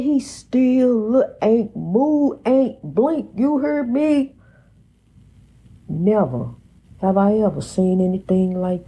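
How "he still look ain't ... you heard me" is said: high, drawn-out storyteller's amazement; emphatic disbelief. Retold or imitated. Telling listeners the cat never blinked, in high disbelief. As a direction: stretch it out high, insisting on being believed. Retells cat's unblinking stare in disbelief.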